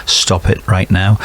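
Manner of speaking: ordinary talk, nothing marked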